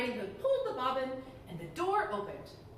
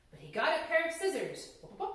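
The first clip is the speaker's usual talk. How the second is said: ordinary delivery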